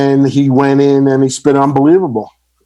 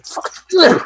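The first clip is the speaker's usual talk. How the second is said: laughing mock curse. Mocking [name] after [name] left.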